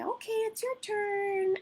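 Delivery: put-on high, slow sing-song to a toddler; toddler-friendly voice. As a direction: coax the little one with a high, sweet invite. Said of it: "I sound ridiculous when I'm doing sessions".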